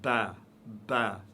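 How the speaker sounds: "ba" light and clean, Arabic style; "ba" with a clear open vowel. Shown as a demonstration. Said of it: "Dans la langue arabe, on dit".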